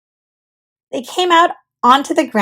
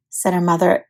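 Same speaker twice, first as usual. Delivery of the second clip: ordinary delivery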